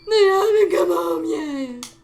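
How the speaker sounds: loud, high wailing sob; wailing cry. Acted. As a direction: wail it out, slow and loud. Cuckoo lady wailing for birds.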